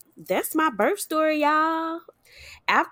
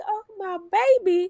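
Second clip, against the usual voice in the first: tearful cry; 11 semitones higher, 5 dB louder, faster than usual. Retold or imitated. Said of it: "started crying, like".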